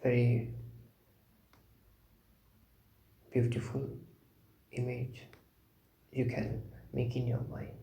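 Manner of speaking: sparse phrases with long, calm silences; guided meditation. Real, not acted. Teacher leading contemplation of Buddha's qualities, meditative.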